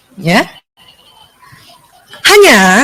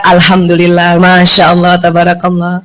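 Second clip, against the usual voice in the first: humble thanks; 9 semitones lower than usual. Shown as a demonstration. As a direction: say it with modest gratitude. (ucapkan syukur dengan rendah hati)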